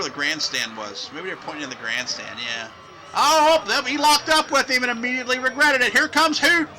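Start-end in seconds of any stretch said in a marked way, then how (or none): 3.1-6.7 high, loud wrestling call; excited play-by-play. Acted. Announcer calling Hoot crawling through the legs, excited shouting. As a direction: burst into the call as the move happens.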